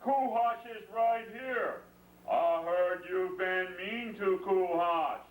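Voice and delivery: a lightly voiced bear who has been told tales. Bear speaks to the worried parents, slow and gently stern. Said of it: "The bear said".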